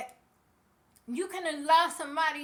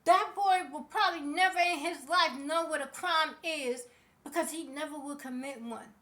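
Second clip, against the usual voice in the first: ordinary delivery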